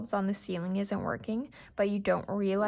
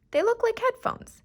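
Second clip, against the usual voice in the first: high, loud, sing-song brightness; cheerful; 7 dB louder than usual. A narrator's reading. Mother talking up the headband to her son, high cheerful. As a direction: chirp it to make it sound fun. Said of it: "trying to be cheerful".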